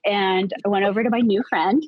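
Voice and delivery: usual speaking voice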